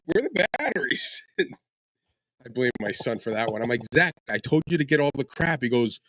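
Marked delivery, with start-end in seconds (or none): 0.1-1.2 high, alarmed question. Retold or imitated. Pilot unloading at the field finds no batteries, high and alarmed. 3.9-5.6 mock scolding. Scolding son retold.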